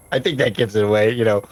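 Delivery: normal talking voice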